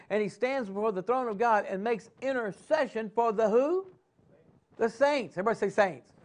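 rising, pressing preacher cadence; preaching. Acted. Preacher rallying his congregation, in full sermon cadence.

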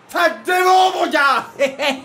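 gleeful taunt. Real, not acted. Gamer taunting the beaten opponent, loud gleeful jeer. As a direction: rub it in his face.

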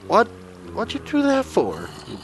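high put-on zombie squawk; mock zombie voice. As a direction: play the zombie insulting the plumber. Voicing zombie insult.

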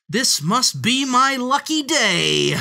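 high, loud, drawn-out exulting; shouting. A narrator's reading. Fox celebrates catching the piglet, shouting. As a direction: crow over a lucky catch. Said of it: "the fox shouted".

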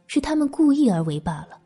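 usual speaking voice

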